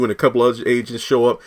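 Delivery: usual speaking voice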